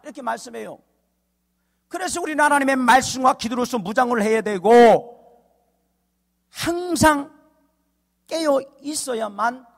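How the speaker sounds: ringing, measured pulpit oratory; forceful preaching. Real, not acted. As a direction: drive each phrase home to the congregation.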